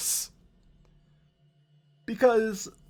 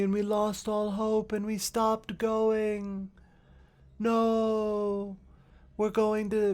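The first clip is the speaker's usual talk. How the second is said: mopey Ea; 5 semitones higher than usual. Retold or imitated. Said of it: "the Ea of Final Fantasy fourteen were just like".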